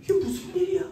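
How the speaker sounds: bewildered disciple. Acted. Disciples looking at each other, bewildered. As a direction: ask it in acted bewilderment. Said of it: "서로 얼굴을 쳐다보며".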